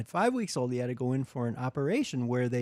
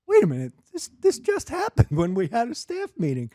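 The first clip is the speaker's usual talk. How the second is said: retold indignant surprise; 8 semitones higher, 4 dB louder than usual. Boss realizes what happened, acted out.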